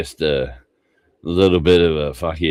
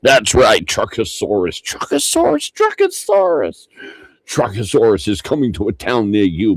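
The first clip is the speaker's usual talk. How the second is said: monster truck announcer; 8 semitones higher than usual. Acted.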